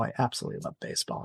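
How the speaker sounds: "I absolutely love baseball" whispered fast and breathy; hushed whisper. Real, not acted. Guest confiding her passion to the host in a whisper. Repeats the line, then drops it.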